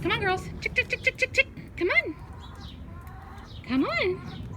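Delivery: calling chickens. Real, not acted.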